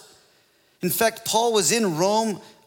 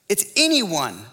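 "anyone" pushed high and louder, then falling; stress on anyone; 3 semitones higher, 4 dB louder than usual. Real, not acted. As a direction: punch the word "anyone". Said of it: "is the emphasis here".

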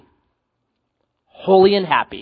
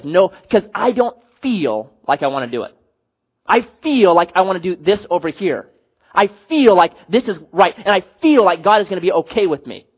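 "feel" leaned on hard each time; stress on FEEL; 3 semitones higher, 5 dB louder than usual. Retold or imitated. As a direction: punch the word "feel" in every clause, mimicking an excuse. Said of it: "Notice how I stress the word feel".

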